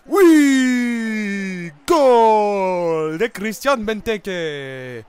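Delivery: loud, drawn-out goal call; real relief as his striker scores at last; goal celebration. Real, not acted. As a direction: stretch out the goal like a commentator. His player finally scores.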